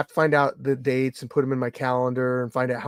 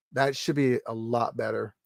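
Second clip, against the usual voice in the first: ordinary talk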